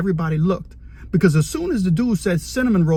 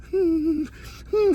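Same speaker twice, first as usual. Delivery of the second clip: soft moan; 8 semitones higher, 3 dB quieter than usual. Retold or imitated. Cellmate moaning while calming his hand.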